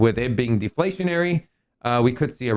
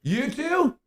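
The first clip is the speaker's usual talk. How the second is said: mock surprise; 11 semitones higher, 3 dB quieter than usual. Mock surprise at non-likers.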